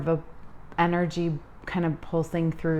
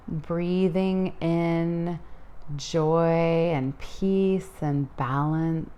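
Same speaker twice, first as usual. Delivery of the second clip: guided meditation. Acted.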